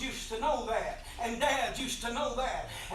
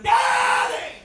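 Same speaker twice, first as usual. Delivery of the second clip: piercing high shriek, much higher and louder; screaming child; 13 semitones higher, 12 dB louder, much slower than usual. Retold or imitated. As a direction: scream for your parents from the fire.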